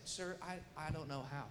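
soft, hesitant retold answer; meek reply. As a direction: answer meekly, well under your voice.

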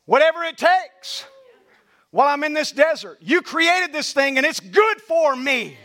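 slow, pleading prayer lines; fervent prayer. Acted. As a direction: pray each line with rising longing.

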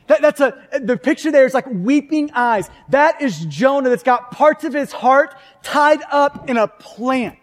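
ordinary talk, nothing marked

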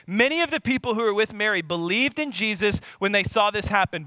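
normal talking voice

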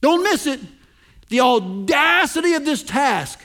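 high and loud, building excitement; preacher pointing hard at the key word; emphatic excitement. Real, not acted. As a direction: urge them not to miss it. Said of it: "I love the way Christ says".

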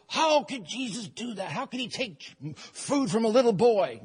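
lightly voiced complaining crowd; shrill cry. Crowd protesting Jesus taking boy's food, shrill complaint. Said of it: "The shrill cry".

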